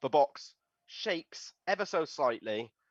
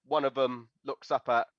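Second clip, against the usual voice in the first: ordinary delivery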